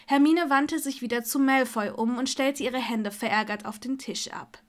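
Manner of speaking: plain reading voice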